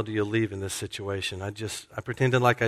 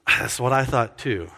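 ordinary delivery

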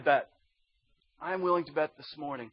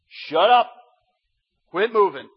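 loud, sharp command to the storm; commanding rebuke; 4 semitones higher, 11 dB louder than usual. Retold or imitated. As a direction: order the wind to be still.

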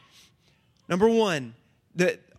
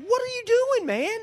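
high, puzzled what are you doing man; incredulous servants; 14 semitones higher than usual. Retold or imitated. Servants asking Naaman why he came home, high incredulous. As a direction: play the baffled servants calling him out.

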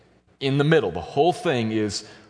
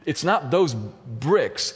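usual speaking voice

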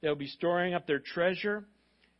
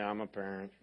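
flat unexcited parent; 10 semitones lower, 8 dB quieter than usual. Retold or imitated. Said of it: "some of you are like".

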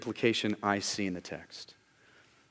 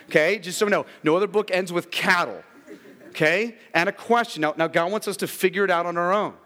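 loud, high, driving sermon voice; full-force preaching on Jonah's ending; 7 semitones higher, 7 dB louder than usual. Real, not acted. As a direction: preach it out to the back row.

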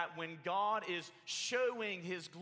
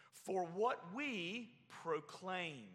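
slow emphatic reading; 3 semitones higher, much slower than usual. Shown as a demonstration.

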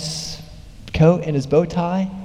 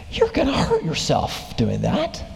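sneering neighbor; 4 dB quieter than usual. Retold or imitated. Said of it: "sneers at him with just disgust".

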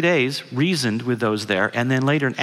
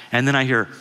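usual speaking voice

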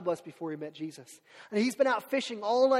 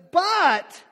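loud protesting but; 10 semitones higher, 12 dB louder than usual. Acted. Peter's hesitant objection.

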